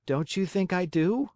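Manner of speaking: put-on high boyish puppet voice; a lightly voiced young puppet; Pinocchio voice. A narrator's reading. Pinocchio asks.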